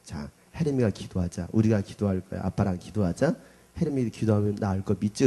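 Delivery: gentle coaxing. Retold or imitated. Father coaxing daughter to pray.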